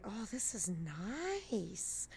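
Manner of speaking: a slow, easy sigh of pleasure going downhill; relaxed skier. Acted. Teacher voicing the skier gliding downhill, slow and content. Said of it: "he's gonna say".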